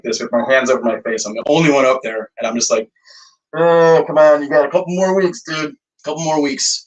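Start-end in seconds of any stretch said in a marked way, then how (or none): 3.5-6.8 higher-pitched self-coaxing groan, easing off at the end; weary pep talk. Retold or imitated. Musician coaxing himself through the tour's last weeks, pep talk. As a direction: talk yourself through it, starting loud. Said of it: "giving myself a little pep talk".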